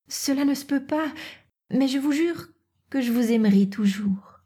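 high, soft, sorrowful refusal; compassionate young Natacha. Acted.

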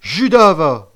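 furious, very loud yell. A narrator's reading. Outraged boy cursing the traitor, yelling. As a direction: hurl the insult at full voice. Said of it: "hurla Gambette, terriblement ému".

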